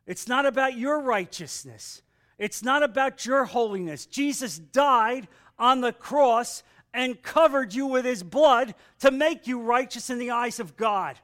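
emphatic preaching. Real, not acted. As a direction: preach it out forcefully.

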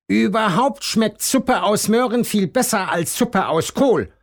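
high insistent rabbit voice; high-voiced stubborn bunny; claiming. A narrator's reading. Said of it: "behauptet Hasen Tim".

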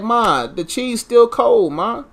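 slow, drawn-out complaint; complaining to mom. Retold or imitated. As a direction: drag it out like a whiny kid. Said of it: "I'm like".

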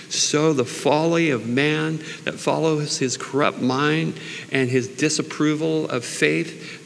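ordinary delivery

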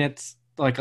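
ordinary delivery

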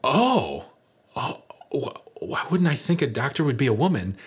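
a hint of a flustered red-faced man; embarrassed husband. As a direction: stammer it out, caught off guard and embarrassed. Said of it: "and he goes".

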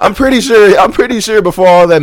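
laughing at his own verdict; laughing while judging the dead patient. Real, not acted.

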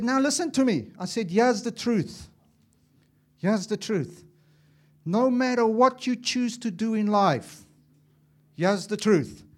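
a father laying down the truth; forceful fatherly warning. Retold or imitated. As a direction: drive the truth home to your sons. Father warns sons.

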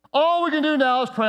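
defeated. Shown as a demonstration. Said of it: "repeat of quote".

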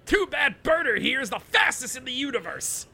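excited, fast gloating taunt; gloating villain. Acted. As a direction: crow it like a smug brute.

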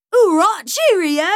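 loud, high fake Cockney; hammed-up British accent. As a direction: sign off in a badly faked English accent. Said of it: "I'm not a good linguist".

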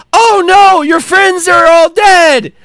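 ordinary talk

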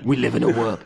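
a light take on the trailer narrator, dramatic; solemn trailer announcer, lightly done; movie trailer voiceover. Retold or imitated. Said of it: "a, a movie trailer voiceover".

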